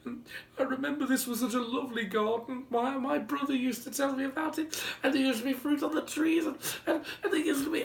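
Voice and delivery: put-on high, breathless childish rambling; a little boy tumbling over his words; rambling small boy. A narrator's reading. Little boy rambles to the giant about the garden, high. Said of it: "the little boy said".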